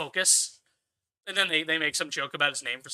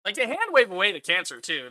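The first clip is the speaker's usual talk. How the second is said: ordinary delivery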